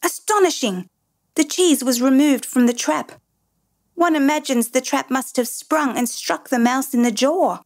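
high-pitched, excited exclaiming. A narrator's reading. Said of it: "came back excitedly, exclaiming".

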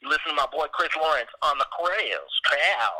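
hyped-up high trailer park rapper voice; a loud, brash J-Rock hype intro. Acted. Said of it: "It's your boy J-Rock".

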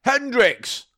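high, loud shouts calling a dog. Pretend. Owner shouting at the dog for licking the guitar. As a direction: yell after a naughty dog across the room.

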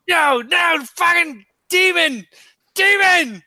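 high-pitched acted scream at a demon; panicked screaming. Player's character screams at the sight of a demon, panicked screaming. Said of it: "he just starts screaming".

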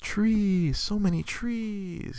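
high, slow, drawn-out awe; a small boy voiced high and slow, fading; dreamy toddler. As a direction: sigh over the trees like a sleepy toddler. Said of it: "he's like".

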